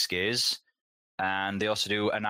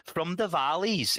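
a slow, hammed-up Welsh lilt on the Valleys; bad Welsh accent; 9 semitones higher, slower than usual. Joking about Welsh whiskey to his cohost, in a bad Welsh accent. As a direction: put on a deliberately awful Welsh accent. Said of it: "that was an awful accent".

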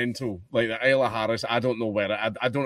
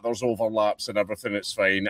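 ordinary delivery

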